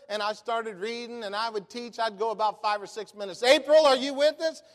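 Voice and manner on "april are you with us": high, loud call down the phone; checking on caller. Retold or imitated. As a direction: re-enact calling out to a listener on the line. Retold calling to caller.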